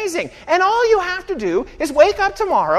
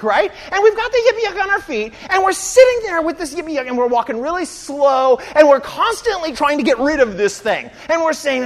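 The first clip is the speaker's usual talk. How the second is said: normal talking voice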